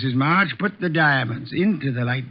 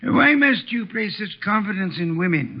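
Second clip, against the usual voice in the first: ordinary talk, nothing marked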